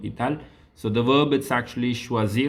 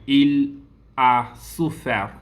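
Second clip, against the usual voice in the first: il a souffert said slowly and a bit louder; slow model answer; 3 semitones higher, 4 dB louder, much slower than usual. Shown as a demonstration. Teacher slowly models the past tense to class. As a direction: stretch out il a souffert for learners. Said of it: "teacher models the answer".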